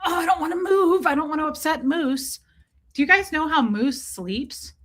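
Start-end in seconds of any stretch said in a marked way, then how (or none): 0.1-2.4 mock sleepy owner. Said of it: "I'm like".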